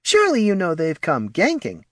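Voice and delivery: high, condescending little voice; smug, high kobold voice; patronizing. Acted. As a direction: explain as if it were obvious. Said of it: "said with a patronizing smile".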